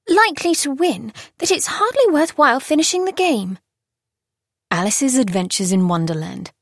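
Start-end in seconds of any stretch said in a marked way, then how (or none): 0.1-3.6 put-on higher girlish voice, quickly smoothing over; a girl flattering the listening Queen in a higher voice; Alice covering herself. A narrator's reading. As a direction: cover your slip with a sweet tone.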